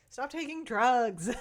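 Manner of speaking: cracking up while repeating the note; laughing. Real, not acted. Vlogger rereads her own note, laughing.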